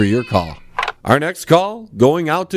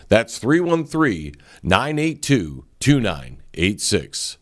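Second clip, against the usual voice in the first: softer, earnest sponsor read; 3 semitones lower, 3 dB quieter than usual. Acted. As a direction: sell the painter like a radio ad. Host reads sponsor ad.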